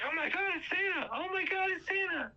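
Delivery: put-on very high little-kid squeal; adorable excitement. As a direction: imitate a thrilled child spotting Santa. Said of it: "he has the most adorable reaction".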